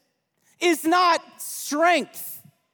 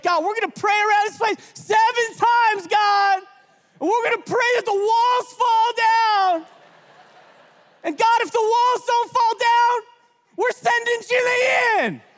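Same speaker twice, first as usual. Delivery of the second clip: bold mock prayer; 8 semitones higher than usual. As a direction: declare it to God with swagger. Joking dare prayer.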